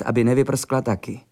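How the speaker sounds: usual speaking voice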